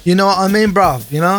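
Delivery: imitating a young Londoner in MLE; mock MLE bruv talk; MLE accent. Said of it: "So he'd be speaking M L E".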